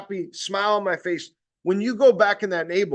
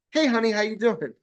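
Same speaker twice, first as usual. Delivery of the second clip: sweet greeting; 4 semitones higher than usual. Retold or imitated. Same lady now sweet.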